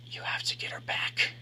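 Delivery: unvoiced ghostly whisper; eerie whisper. A narrator's reading. Reflection whispers to him to win her back. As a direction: breathe it like a voice inside his head. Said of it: "The man's whisper lingers".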